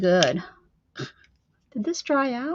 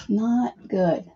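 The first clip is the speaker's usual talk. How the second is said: ordinary talk, nothing marked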